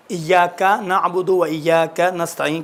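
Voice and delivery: إياك نعبد و إياك نستعين recited slowly, each word articulated apart; careful Quranic pronunciation demo; slow separated recitation. Shown as a demonstration. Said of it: "تلفظ الگ الگ سا کریں".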